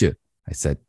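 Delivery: ordinary talk, nothing marked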